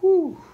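high, blown-out whew; sigh of relief. A narrator's reading. Gus sits down after Frizzle leaves, relieved sigh. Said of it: "with a sigh of relief".

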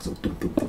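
soft toneless dumm dumm heartbeat; heartbeat imitation. Imitating the knocking sounds for a friend, a breathy heartbeat. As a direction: thump it out under the breath like a heartbeat. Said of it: "Hört sich an wie so ein ganz dumpfer, schneller Herzschlag".

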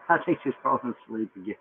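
quick laughing repeat, dropped back down. Real, not acted. Laughing as he repeats the joke about his sleepy wife. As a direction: giggle through it fast and soft.

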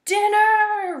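loud, high roaring call. A narrator's reading. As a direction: roar it out like a hungry bear. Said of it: "roared the bear".

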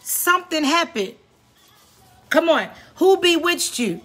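impassioned exhortation, lifted above conversational talk; real preaching. Real, not acted. Preacher urges listeners not to miss their season, preaching. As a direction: press each point home in short bursts, with pauses to let it land.

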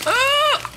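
sudden high yelp; startled shriek. Real, not acted. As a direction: shriek in surprise. Startled by thinking it's poop.

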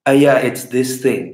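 ordinary delivery

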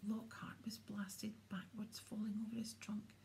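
ordinary delivery, nothing marked

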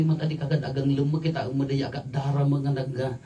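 usual speaking voice

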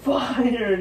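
high mock boss shout; mock McMahon. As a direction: ham up the firing line. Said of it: "The one is like".

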